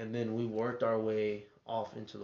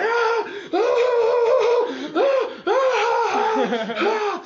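piercing high evil cackle, much louder; evil laugh; 13 dB louder, much slower than usual. Retold or imitated. Uncle mimicked cackling evilly while driving stoned friends. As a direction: cackle like a madman in the driver's seat. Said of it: "an evil sounding fucking laugh".

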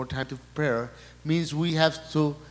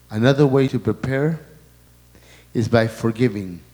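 preaching; 6 dB louder than usual. Acted. Preaching at full force.

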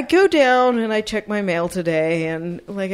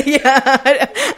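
higher and louder, laughing through it; 5 semitones higher, 6 dB louder than usual. Real, not acted. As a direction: laugh as you confirm it. Laughing while admitting the joke.

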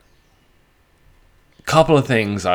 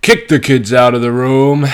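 loud, raised mock announcer shout; hyped-up warning; 4 semitones higher, 10 dB louder than usual. Podcaster warning listeners to clear out kids, hyped-up shout. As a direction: belt out a mock order to clear the room.

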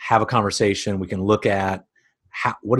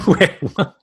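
sudden high laughing disbelief; laughing surprise; 9 semitones higher, 4 dB louder than usual. Real, not acted. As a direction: laugh out a startled question. Baffled by Jack the Ripper tangent.

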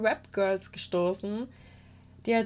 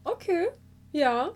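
surprised reaction; 4 semitones higher, 5 dB louder than usual. Retold or imitated. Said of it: "da war ich so".

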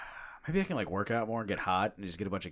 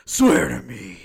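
hammed-up Batman yell; explosive mock-menacing shout; jokingly yelled; 4 semitones higher, 14 dB louder, much slower than usual. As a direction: bellow it like Batman threatening a crook. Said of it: "I still yell that, like, jokingly".

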